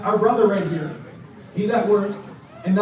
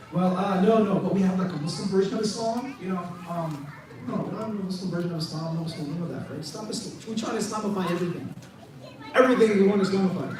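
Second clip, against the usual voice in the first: mock waffling, trailing into a quick, softer mumble; hammed-up beating around the bush; evasive rambling; 2 semitones lower, 3 dB quieter than usual. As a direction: stumble and hedge, then hurry through excuses. Mocks hedging, rambling answer after confident one.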